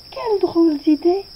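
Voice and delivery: put-on a little boy startled by the idea; mildly shocked child voice. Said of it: "parut choquer le petit prince".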